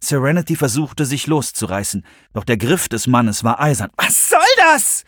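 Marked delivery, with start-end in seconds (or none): 4.0-5.0 very high, loud, drawn-out yell; shrieked protest; outraged scream. A narrator's reading. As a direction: scream it as someone grabs you. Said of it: "schrie sie".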